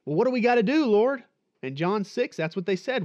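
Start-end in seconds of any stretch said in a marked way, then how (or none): 0.1-1.2 higher and louder, pressing the Lord; eager question. Acted. The crowd in John six pressing Jesus, eager and loud. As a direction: ask it urgently, like the crowd pushing forward.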